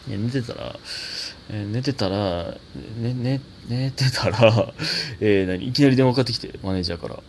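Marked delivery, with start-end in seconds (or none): none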